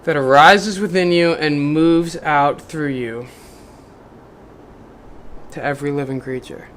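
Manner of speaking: measured inspirational book reading; reading aloud. Acted. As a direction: read it like a devotional passage to the listener. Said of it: "I did a great job reading".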